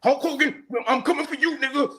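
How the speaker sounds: playful high-pitched wrestler taunt; hammed-up challenge breaking into a laugh; wrestling call-out. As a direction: send a mock threat up high, about to laugh.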